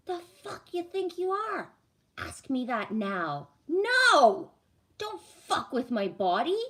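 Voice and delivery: reliving telling off the doctor; retold angry outburst. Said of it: "I was so mad".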